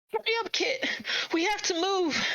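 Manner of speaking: put-on very high child voice, urgent; a frightened child hurrying a sibling; child urging another child to flee, very high and urgent; kids screaming. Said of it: "kids screaming to each other".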